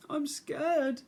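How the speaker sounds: high small timid voice; small scared bear cub. Acted. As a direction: speak as a frightened little cub.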